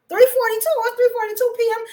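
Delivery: high, disbelieving "three forty-two", then fast; shocked disbelief. Retold or imitated. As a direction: gasp at the time she lost. Realising she slept through day.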